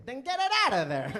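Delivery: high, loud, laughing exclamation; mock outraged shout. Comic telling off a silent letter, loud and high. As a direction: yell it through laughter.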